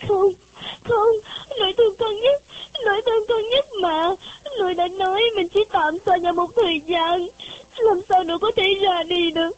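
put-on high, tearful child's voice in grief; a girl weeping at her grandma's grave; child crying. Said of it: "nó bỗng òa lên khóc".

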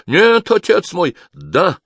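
high, loud, drawn-out protest; frantic high-pitched denial; desperate shouting. A narrator's reading. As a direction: plead innocence at a shout. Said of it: "закричал он".

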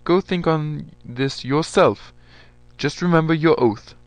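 a general ending the meeting with an order; stern general. A narrator's reading.

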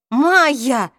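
loud, desperate exclamation of her name; half begging, half furious; pleading angry shout. A narrator's reading. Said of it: "воскликнул Саймон с мольбой и злостью". (умоляющий и злой возглас)

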